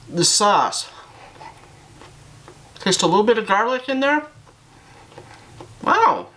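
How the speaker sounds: talking between bites; chewing while talking. Real, not acted. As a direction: mouth partly full of pizza. Seen 2: bite at -5, closed-lip chewing and puffed cheeks through the clip.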